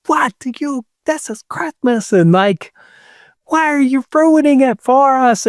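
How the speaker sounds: high, shrill-pitched mock scream; retold screaming. Amy screams about ruining Christmas, high imitated shriek.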